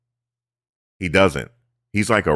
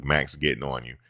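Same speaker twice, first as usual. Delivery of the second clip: ordinary talk, nothing marked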